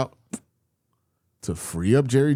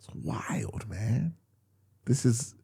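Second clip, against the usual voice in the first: whispered disbelief; 8 dB quieter, slower than usual. Real, not acted. Disbelief at the quarterback's miss.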